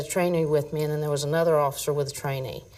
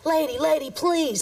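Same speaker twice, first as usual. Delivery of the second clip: higher, whiny begging voice; pleading suspect; 10 semitones higher than usual. Retold or imitated. Officer retelling the pinned suspect's begging, higher and whiny. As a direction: beg like the man pinned under your boot. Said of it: "this guy's down here going".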